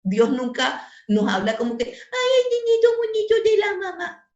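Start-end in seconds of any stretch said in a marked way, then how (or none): 1.9-4.3 high sing-song coddling voice; mock baby talk. Preacher mocking sweet baby talk to a child. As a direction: coo it like a doting mother.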